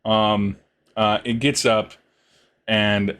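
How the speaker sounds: ordinary talk, nothing marked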